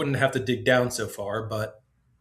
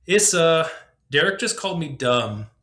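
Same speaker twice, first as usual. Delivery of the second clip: put-on loud whiny kid complaint; whiny tattling child; 5 semitones higher, 4 dB louder than usual. A narrator's reading. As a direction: tattle to the older sister.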